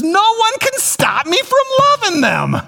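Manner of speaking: high-pitched, fervent preacher's declaration; emphatic proclamation. Real, not acted. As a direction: proclaim it with full conviction, pitched far up. Proclaims his new declaration.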